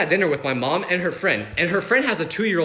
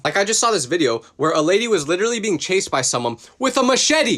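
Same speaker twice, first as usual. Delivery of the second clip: ordinary talk, nothing marked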